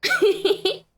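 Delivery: high, delighted cry with a laugh; joyful laughing shout; excited squeal. Real, not acted. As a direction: squeal the name with glee.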